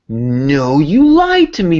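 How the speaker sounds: high, loud cry of betrayal, drawn out; mock outraged cry. Retelling his reaction to a movie twist, loud mock outrage. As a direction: wail it like you've been cheated by the film. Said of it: "that same reaction getting at the end going".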